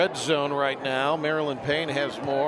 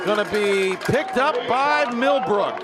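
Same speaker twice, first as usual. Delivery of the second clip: excited play-by-play; 6 semitones higher than usual. Real, not acted. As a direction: call the fumble as it happens. Live call of a fumble.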